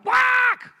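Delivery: very high, very loud quack; one duck squawking. Retold or imitated. One duckling wanting the bread most, shrieking quack.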